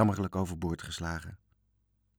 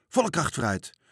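high, moderately raised order; commanding shout; 6 semitones higher than usual. A narrator's reading. Little captain orders full speed ahead, in a raised shouting voice. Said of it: "beval de kleine kapitein met een schreeuwstem".

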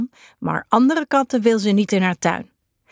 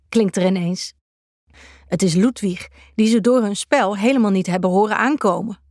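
plain reading voice

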